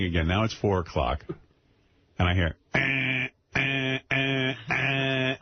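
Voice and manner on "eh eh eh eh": short, repeated buzzer noises; droning "eh" like a doorbell; imitated doorbell buzz. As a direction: mimic an insistent door buzzer. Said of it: "It's my front doorbell".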